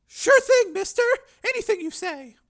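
put-on very high, loud pleading girl; terrified girl. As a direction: shriek in terror. Frightened girl.

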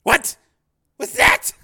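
high, loud, incredulous demand; angry challenge. Acted. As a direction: dare her to repeat it. Pimp challenges her refusal.

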